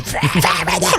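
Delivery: high mock screech of insane singers; imitated screech. Describing a band shouting like witches on fire, mock screeching.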